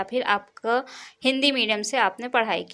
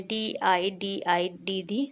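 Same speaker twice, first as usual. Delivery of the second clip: डी आई डी आई spelled slowly, softer; slow spelling; 4 dB quieter, slower than usual. Shown as a demonstration. Teacher contrasting दीदी spelling, slow. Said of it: "जैसे आप दीदी लिखते हैं".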